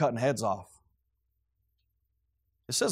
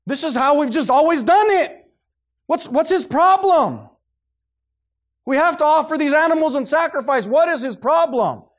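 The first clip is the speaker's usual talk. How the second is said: huffy sellers offended by the disruption; indignant merchants; 11 semitones higher, 8 dB louder than usual. Retold or imitated. Temple merchants protest the crazed man, loud and outraged.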